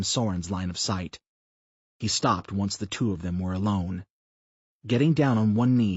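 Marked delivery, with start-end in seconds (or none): none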